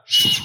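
forceful "shh" to silence a storm; sharp shushing. Acted. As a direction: hush the wind with one hiss. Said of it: "I'd be like".

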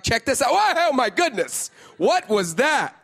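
loud, high, thrilled showing off; excited God. Retold or imitated. God shows angels his creation, loud and thrilled. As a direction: announce a new creation with delight. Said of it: "And he's like".

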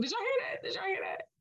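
high amused surprise at herself; surprised. Real, not acted. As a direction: squeak it out, amused. Surprised at her own accent.

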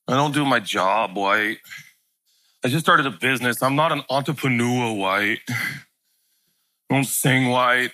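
mope like nothing goes right; mopey self-pity in a low voice; mopey whine. Retold or imitated. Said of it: "you ever felt like that".

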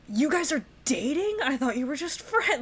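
high-pitched playful astonishment; mock shock. Oblivious Peter learns the two are dating, astonished. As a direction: act completely floored by the news.

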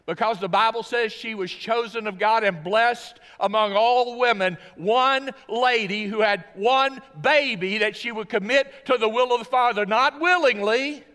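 loud, high, ringing pulpit voice; booming sermon delivery building to a climax; full-force preaching. Acted. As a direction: preach it out to the back row.

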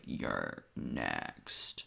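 low eerie whisper; creepy whisper. Retold or imitated. Voicing the movie ending, low eerie whisper. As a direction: breathe it like a horror tagline.

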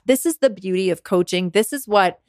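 usual speaking voice

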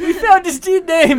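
high, loud laughing dog voice. Pretend. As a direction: yelp it like a silly dog.

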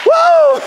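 preacher's ecstatic whoop; loud high whoop. Real, not acted. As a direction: cut off mid-word and shout for joy. Preacher peaks in praise.

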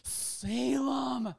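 high, drawn-out scolding call; imitated stern shout. Imitating partner scolding the dog during an embrace, stern. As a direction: mimic a stern owner calling the dog, held back in volume. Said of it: "but you're like".

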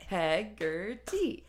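Hegarty split into syllables, slow, rising. Shown as a demonstration. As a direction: clap out Hegarty one syllable at a time. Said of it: "So we can clap out the syllables".

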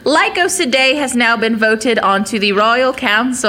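grand herald proclamation; grand rich voice. Acted. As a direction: announce it to the whole square. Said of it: "stereotypical, uh, town crier".

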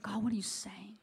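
soft, unvoiced whisper to God; whispered prayer. Friends praying together, asking God in a whisper. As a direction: breathe the question as a hushed prayer.

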